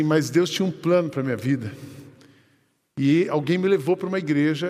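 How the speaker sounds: usual speaking voice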